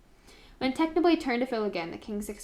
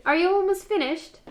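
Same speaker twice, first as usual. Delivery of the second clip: put-on loud, high kid's call in an accent; Tommy's accent; 10 semitones higher, 11 dB louder than usual. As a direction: shout down to dad in Tommy's accent. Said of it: "I just did an accent for Tommy".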